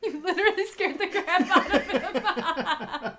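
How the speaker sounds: loud, slow words breaking into real laughter; laughing hard. Real, not acted. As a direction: crack up while saying it. Cracking up at the story's punchline.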